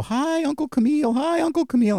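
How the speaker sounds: put-on very high, sing-out child voice; little kids. Preacher imitating little kids greeting the injured guest, little kids' voice. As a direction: chirp it like excited small children greeting someone. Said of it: "they're all little kids".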